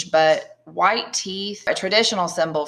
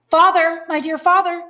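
high, loud joyful call to his father; excited young son; 12 semitones higher, 5 dB louder than usual. Acted. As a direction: cry out with joy on seeing father again. Dantes greets father, story reading.

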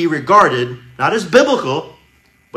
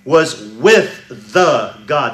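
"with the God" drawn out slowly; slow literal gloss; 2 semitones lower, 5 dB louder, much slower than usual. Shown as a demonstration. Preacher glossing the Greek, slow emphasis. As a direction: translate it slowly, weight on "with". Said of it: "In Greek, it's".

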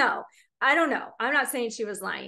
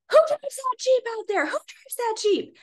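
high-pitched mock angry demand; retold indignant outburst, high and insistent; upset man; 13 semitones higher than usual. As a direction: imitate an irate neighbour confronting someone, pitched way up. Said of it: "he was very upset".

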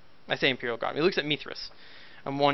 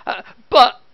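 timid guardsman; 8 semitones higher, 11 dB louder than usual. Acted. Guardsman about to object.

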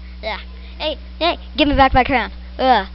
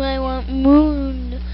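slow drawl; 4 dB quieter, much slower than usual. Pretend. Drawn out moo voice.

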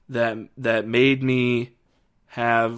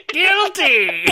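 very high, loud hammed-up cry; mock verdict shout; 7 dB louder than usual. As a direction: shriek the verdict in comic imitation. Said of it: "and he says".

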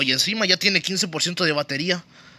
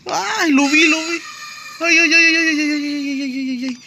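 high, frantic panicked squeals; panicked shrieking; 13 semitones higher, slower than usual. Real, not acted. Hiding from monster in panic.

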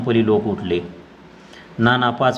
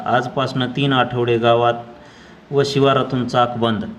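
ordinary delivery

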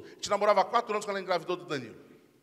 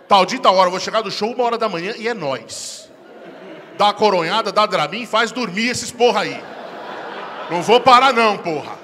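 loud, cocky self-talk with laughs; loud boast; 2 semitones higher, 8 dB louder than usual. Acted. Husband loudly psyching himself up for the night. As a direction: brag loudly about the night ahead.

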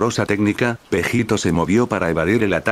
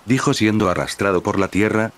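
usual speaking voice

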